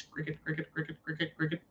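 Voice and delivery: very soft mock cricket chirping; cricket chirps. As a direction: murmur it like crickets in the dark. Mocking media silence as crickets.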